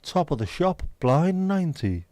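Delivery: drawn-out bingo hall calls; hammed-up bingo caller. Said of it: "Doing me bingo voice".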